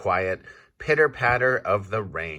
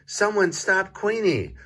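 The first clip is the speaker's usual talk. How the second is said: high alarmed cry; 12 semitones higher than usual. A narrator's reading. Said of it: "But Quincy's voice is too quiet".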